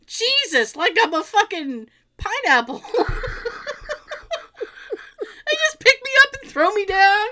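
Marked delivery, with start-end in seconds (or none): 2.9-7.3 high laughing complaint. Real, not acted.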